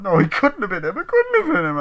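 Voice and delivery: high, loud, frantic protest; hammed-up panicked pleading; panicky denial. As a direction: girlfriend insisting her boyfriend is innocent, high and frantic. Girl denies the boyfriend killed.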